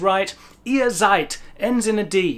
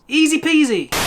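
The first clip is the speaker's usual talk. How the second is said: higher, louder sing-song easy peasy; 7 semitones higher, 5 dB louder than usual. Acted. Teacher cheerfully closing the rule, high sing-song. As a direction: toss it off brightly like a nursery rhyme.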